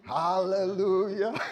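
high, loud, drawn-out praise; jubilant response. Shown as a demonstration. Preacher showing the congregation how to respond, jubilant. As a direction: sing out the praise slowly and loudly. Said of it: "you should be saying".

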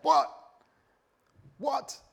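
high, loud startled double take; mock shocked Peter. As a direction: blurt it out in disbelief.

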